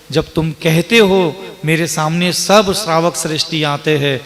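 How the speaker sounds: normal talking voice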